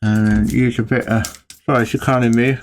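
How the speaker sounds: speaking with a paintbrush in mouth; garbled around a brush held in the mouth. Real, not acted. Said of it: "I've got a brush in my mouth".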